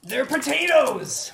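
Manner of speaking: high, loud burst on the joke answer; mock exclamation. Real, not acted. Game master answering what the missiles look like, mock exclamation. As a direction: blurt it out loudly, pitched right up.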